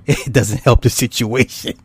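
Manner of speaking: words squeezed out through laughter; laughing. Real, not acted. Host admitting paranormal videos spook him, laughing. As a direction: trail off into real laughter.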